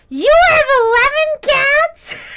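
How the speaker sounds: high, loud, drawn-out amazement; tipsy old lady. Retold or imitated. Regular's wife after two Chardonnays hears about cats, tipsy shriek. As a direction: imitate a tipsy old lady squealing in disbelief.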